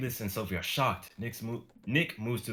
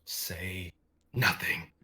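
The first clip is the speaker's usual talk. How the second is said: demon whisper; 2 semitones lower, much slower than usual. Acted.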